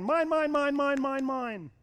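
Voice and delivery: high, greedy sing-song chant; childish mine mine. Retold or imitated. Laban grabbing everything, in a high childish chant. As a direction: whine it like a greedy toddler. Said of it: "Laban's sitting here saying".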